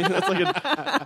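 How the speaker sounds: high squeak breaking into laughter; laughing. Real, not acted. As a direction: lose it to laughter. Laughing mid-sentence.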